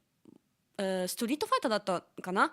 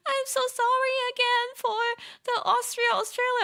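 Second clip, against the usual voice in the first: high, louder sorry voice; imitating Vivi's apology; 14 semitones higher, 5 dB louder than usual. Vivi apologizing to the streamer again, high mock apology. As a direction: mimic her rushed apology up high. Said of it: "she was like".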